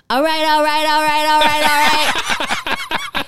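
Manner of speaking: high, loud party yell; drunken shouting. Retold or imitated. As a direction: holler it from the kitchen. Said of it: "I hear".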